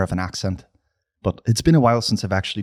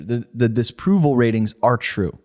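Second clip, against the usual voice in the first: ordinary talk, nothing marked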